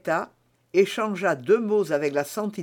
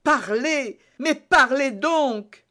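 higher, louder, pressing outcry; urgent cry; 8 semitones higher, 4 dB louder, slower than usual. A narrator's reading. Felton cries out urging Milady to speak. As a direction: demand she speak, rising to a cry. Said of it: "s'écria le jeune officier".

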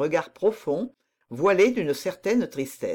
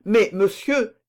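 higher, louder, mildly alarmed protest; almost frightened; 5 semitones higher, 6 dB louder, slower than usual. A narrator's reading. Son taken aback by his father's condescension, slightly frightened. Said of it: "dit Raoul presque effrayé de cette condescendance paternelle".

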